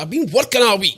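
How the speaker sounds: high, loud mock protest; pleading his case at full pitch; indignant protest. Friend nagging him, he protests high and loud. Defending himself to mate.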